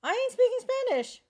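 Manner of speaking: high, loud, insistent no; flatly refuse, voice up high; emphatic refusal. Real, not acted. Friend pushes her to pass as Mexican, loud refusal.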